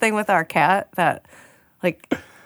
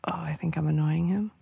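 whisper; 4 semitones lower, 7 dB quieter than usual. Retold or imitated. Retelling a worried thought.

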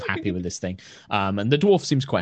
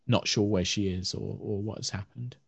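ordinary delivery

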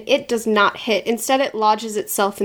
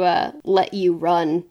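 normal talking voice

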